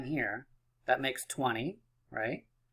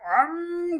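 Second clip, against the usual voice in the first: engine revving up high; high-pitched playful vroom; high engine rev. Pretend. Teacher launching the first peek, high engine rev. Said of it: "Revving those engines".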